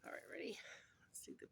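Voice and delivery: hushed excited whisper. Real, not acted. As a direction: whisper like unveiling a treat. Hushed reveal of the food.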